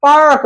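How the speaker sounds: पार्क with a strong R; American pronunciation. Shown as a demonstration. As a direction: stretch out the R in पार्क before the K. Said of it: "American में English में जो है इसे". (पार्क में लंबा, दोहराता र)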